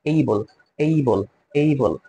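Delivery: এইবল with an ei glide, slowly; drawn-out এইবল, ei not e; say eibol. Shown as a demonstration. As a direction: able pronounced এইবল. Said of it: "এবল না বলে এইবল বলবো".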